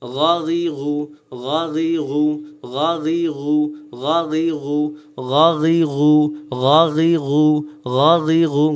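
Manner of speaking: গাগিগু slowly, each g a gargled gh; gargling sound. Shown as a demonstration. Teacher drilling ghain with vowels, slow gargled sound. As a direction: rasp every syllable of গাগিগু in the throat. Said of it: "গড় করার মতো".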